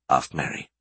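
ordinary delivery

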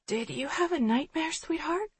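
drowsy, soft and high; half-asleep mother murmuring; sleepy. A narrator's reading. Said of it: "just as sleep-muddled".

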